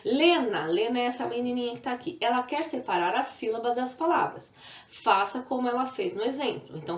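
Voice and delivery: ordinary talk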